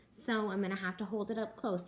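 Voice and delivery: ordinary delivery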